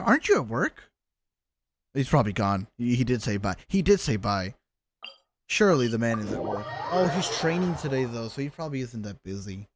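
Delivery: ordinary talk, nothing marked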